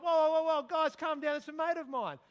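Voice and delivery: loud high urgent call; calling to panicked train passengers to calm down; calming the crowd. Retold or imitated. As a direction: settle the panicked crowd loudly.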